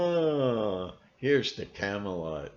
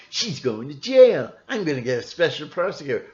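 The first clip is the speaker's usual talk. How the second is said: mocking Trump; 4 semitones higher, 8 dB louder, faster than usual. Quoting Trump's promise.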